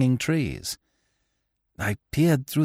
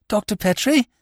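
higher, anxious enquiry; a worried wife greeting the doctor; lightly voiced nervous woman; nervous question; 11 semitones higher than usual. A narrator's reading. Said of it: "she asked, nervously".

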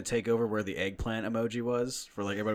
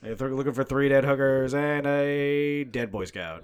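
gleeful announcing; 4 semitones higher, 7 dB louder than usual. Acted. Loud slow gleeful announcement.

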